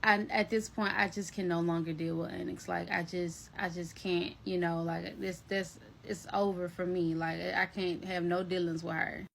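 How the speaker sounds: low, subdued, defeated quote; retold distraught friend. Distraught Kay done with the cat, low subdued retelling. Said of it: "she's just, like, distraught".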